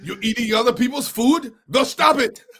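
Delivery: quick, hammed-up Austrian movie-star voice; Arnold Schwarzenegger impression. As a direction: play it like the kindergarten cop, hammed-up. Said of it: "my favorite Arnold Schwarzenegger line is".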